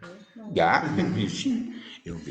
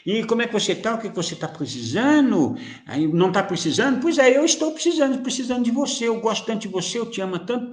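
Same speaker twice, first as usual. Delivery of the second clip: cheerful phone greeting; 8 semitones higher than usual. Pretend. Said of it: "Use isso aqui pra conversar, pra falar".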